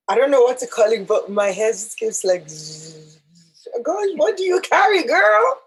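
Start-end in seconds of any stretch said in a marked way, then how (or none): none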